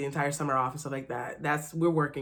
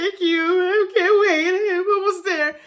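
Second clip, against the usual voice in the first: high and loud, breaking into tears; overwhelmed thanks, voice breaking; crying thanks; 13 semitones higher, 8 dB louder, slower than usual. Real, not acted. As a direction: sob out the thanks slowly. Retelling emotional thanks.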